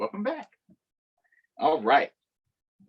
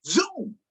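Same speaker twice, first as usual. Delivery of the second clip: high zoom sound effect; 9 semitones higher than usual. A narrator's reading. Toy plane sound.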